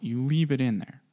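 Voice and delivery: ordinary talk, nothing marked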